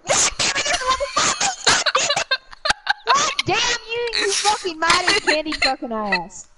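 shouting. Acted. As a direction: yell as if spotting it. Demonstrating louder screaming.

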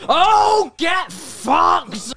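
combat shout. Real, not acted.

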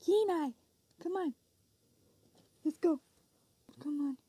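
loud call to the dog. Real, not acted. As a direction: shout for him to come. Calling the dog back.

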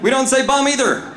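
high, loud mock reprimand; scolding dad. Dad correcting his son's word, scolding. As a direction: lay down the rule as an indignant dad.

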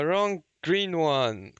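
ordinary delivery

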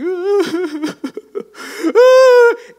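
loud, high-pitched hammed-up wail; exaggerated comic sobbing that rises into a long wail; mock weeping. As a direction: fake a big cartoon cry. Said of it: "he's weeping".

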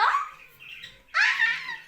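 a very high, short squeal. Real, not acted. Girl squealing at her pet in her ear. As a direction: squeal at the tickle.

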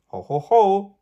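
big loud jolly ho ho ho; booming Santa chuckle; Santa's ho ho ho. Acted. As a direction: belly laugh like Santa. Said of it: "a little round belly that shook when he laughed".

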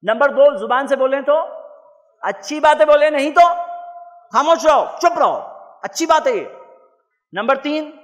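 rally the crowd, booming each point; preacher urging the crowd to repeat, loud and high; loud call and response. Real, not acted. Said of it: "آپ لوگ بھی بول رہے ہیں".

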